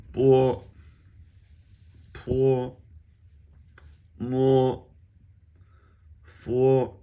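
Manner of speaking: Mandarin initials from "Bo" to "he", each loud on a high level pitch; repeat-after-me drill. Shown as a demonstration. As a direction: chant "Bo, po, mo, fo" one at a time, high and held, for a class. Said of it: "Just repeat after me".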